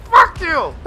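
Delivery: high, loud squealing curse; tank voiced cursing. Commentator voicing the tank, high loud curse. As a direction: spit it out in a shrieking tank voice.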